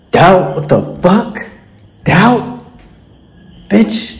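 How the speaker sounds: high, rising disbelief at the word doubt; mock incredulous. Gym bro mocking doubt with high, incredulous squeals.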